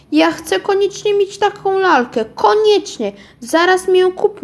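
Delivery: put-on high, insistent little girl's plea; child calling out. A narrator's reading. Little girl begging her mother loudly for the doll. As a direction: whine it up high like a girl who must have the doll. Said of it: "wołała".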